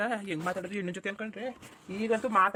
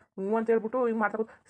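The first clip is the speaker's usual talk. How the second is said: ordinary talk, nothing marked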